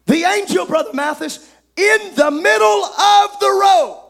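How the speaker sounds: rising, shouted preacher's cadence; full-force preaching. Acted. Preacher to his congregation, full-force preaching. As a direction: drive it home to the pews.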